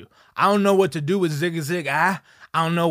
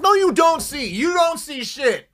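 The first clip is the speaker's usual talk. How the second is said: higher, louder frustrated outburst; exasperated yell; 7 semitones higher, 4 dB louder than usual. Retold or imitated. The boyfriend shouts at the therapist, higher and louder. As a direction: throw up your hands and shout it. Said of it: "I'm like".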